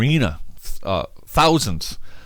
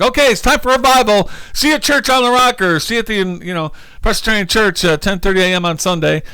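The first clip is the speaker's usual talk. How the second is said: high-pitched, chirpy promo send-up; playful high announcement voice; mock event announcer; 9 semitones higher than usual. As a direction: pitch it like a peppy revival plug. Mimics God announcing a scheduled revival.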